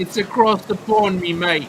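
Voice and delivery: put-on a clumsy British accent tried for fun; bad British accent. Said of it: "can't do a British accent".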